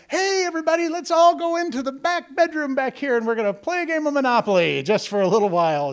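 high, over-bright call to everybody, easing off; cheery diversion. Retold or imitated. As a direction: herd everyone away with loud false cheer, tapering down. Calls children away from photo shoot.

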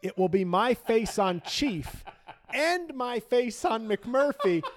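high, loud laughter through words; laughing. Real, not acted. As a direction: crack up while you say it. Laughing at his own bit.

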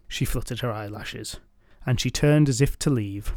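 ordinary delivery, nothing marked

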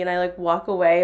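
ordinary talk

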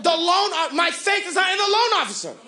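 fired-up preacher, punching the phrases; full-force preaching. Real, not acted. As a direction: build into a shouted declaration of faith.

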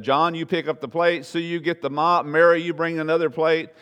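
loud, high instructions; bossy organizer. Acted. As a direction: hand out jobs like a manager. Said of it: "the gift of administration has already said".

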